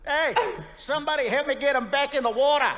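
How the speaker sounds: high, loud Aussie yell about the big fella; Aussie beachgoer. Retold or imitated. Beachgoer yelling to others at the shore, loud mock Aussie voice. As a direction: call out like a rowdy Australian at the beach. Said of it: "To me".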